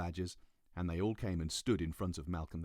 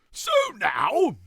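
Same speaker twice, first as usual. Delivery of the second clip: much louder, higher proclamation; grand royal shout; 17 dB louder than usual. Acted. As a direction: announce the rescue boldly. King shouting.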